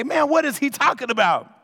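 lifted, mock bewildered ask. Shown as a demonstration. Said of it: "now turn to the other neighbor and say".

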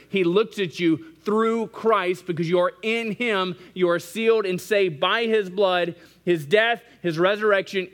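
high, loud, ringing sermon climax; full-force preaching. Acted. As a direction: preach it out, building to the peak.

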